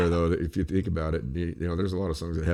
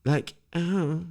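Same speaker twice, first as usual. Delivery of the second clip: high mocking valley-girl gasp; hammed-up high valley-girl voice; 10 semitones higher, much slower than usual. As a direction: squeal it like a gossipy teen. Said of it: "every other word is "like".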